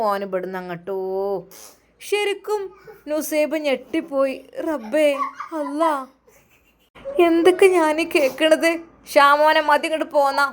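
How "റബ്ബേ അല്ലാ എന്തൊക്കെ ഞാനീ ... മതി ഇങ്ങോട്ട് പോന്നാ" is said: higher, louder exasperated scolding; exasperated mother. Acted. Mother orders son home over the phone, loud exasperation. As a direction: demand he come home now.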